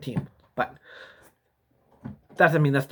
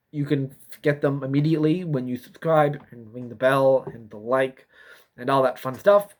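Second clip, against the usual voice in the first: ordinary talk, nothing marked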